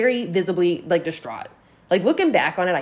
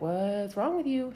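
slow, softened retold question; drawn-out mock concern; wary questioning; 7 dB quieter, slower than usual. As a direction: drag the question out quietly.

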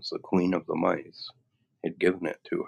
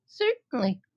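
very high, tiny mouse squeak; squeaky little voice. Acted. Queen of the Mice offering help, high squeak.

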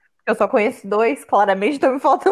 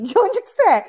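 driver imitated; 8 semitones higher than usual. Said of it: "falou assim".

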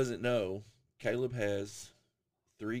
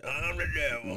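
put-on old man devil voice; 6 semitones higher, 4 dB louder than usual. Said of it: "That's my old man devil voice".

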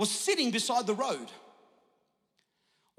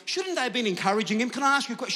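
normal talking voice

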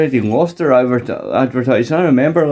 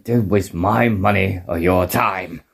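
lower, slower and softer grumble; gruff Scrooge voice; 6 semitones lower, 4 dB quieter, slower than usual. Retold or imitated. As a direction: grumble it low like a miser. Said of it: "Yeah, like".

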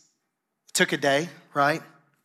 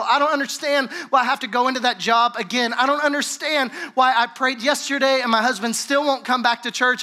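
pleading, higher-pitched complaint to God; frustrated believer begging God, higher and pressing; desperate prayer; 6 semitones higher than usual. Retold or imitated. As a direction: plead with God like you're at the end of your rope. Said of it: "you get desperate in the gap".

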